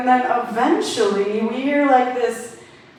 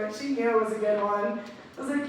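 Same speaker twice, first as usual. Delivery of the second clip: ordinary talk, nothing marked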